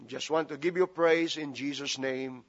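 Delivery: normal talking voice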